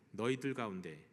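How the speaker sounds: ordinary talk, nothing marked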